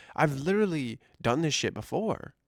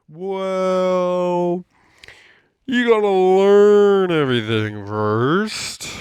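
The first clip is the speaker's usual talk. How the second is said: loud, higher, slow mock manager; imitating the store manager; 5 semitones higher, 9 dB louder, much slower than usual. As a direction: talk down to him, loud and drawn out.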